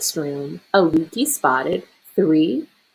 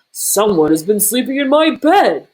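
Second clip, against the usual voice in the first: louder bellowing bear voice; bellowing Papa Bear; 3 semitones higher, 5 dB louder than usual. A narrator's reading. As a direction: bellow like an outraged big bear. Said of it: "Papa Bear bellowed".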